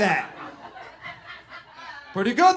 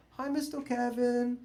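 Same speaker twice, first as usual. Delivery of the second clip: put-on kids' greeting; 3 semitones higher than usual. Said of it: "if I walk into a classroom and I don't have a hat on".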